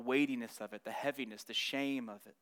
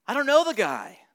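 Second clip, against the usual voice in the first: loud, forceful denial shout; swearing denial; 6 semitones higher, 11 dB louder than usual. Retold or imitated. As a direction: throw the denial out hard. Peter swears denial, acted.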